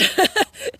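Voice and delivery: loud, high burst of laughter; amused laugh. Real, not acted. Author teasing that the editor may not watch, amused laugh. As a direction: laugh out loud at your own aside.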